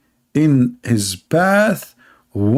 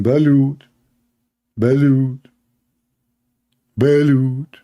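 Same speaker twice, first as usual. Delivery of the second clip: vendor calling out; slower than usual. Retold or imitated. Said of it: "he started screaming".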